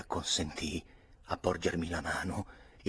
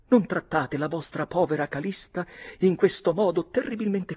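high, louder pleading cry of the widow; 11 semitones higher, 5 dB louder than usual. A narrator's reading. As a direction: cry out at him in despair. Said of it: "gridò la vedova". (grido disperato della vedova)

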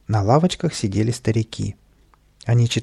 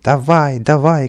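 louder, higher urging cry; shouting; 4 semitones higher, 6 dB louder, slower than usual. A narrator's reading. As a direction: yell him on. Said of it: "кричал Каприска".

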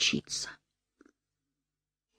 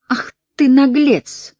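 high, slow scolding yell; angry shout; 10 semitones higher, 7 dB louder, slower than usual. Acted. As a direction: shout it with outrage. Wolf-woman scolding boy.

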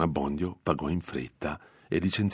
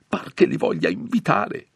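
high-pitched anxious reproof; agitated scolding; 8 dB louder than usual. A narrator's reading. Priest frets at companions, agitated scolding. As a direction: scold them in a strained, raised voice.

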